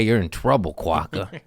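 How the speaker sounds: ordinary talk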